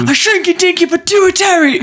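high, loud cartoonish squeal of a voice; silly impression. Cutting in on friend's request, high silly voice.

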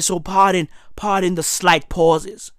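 soft, low, fading voice; losing voice. Real, not acted. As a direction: let the voice fade as it gives out. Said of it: "I'm losing my voice".

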